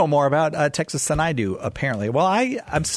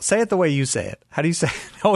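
normal talking voice

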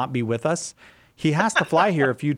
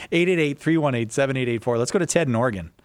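ordinary delivery

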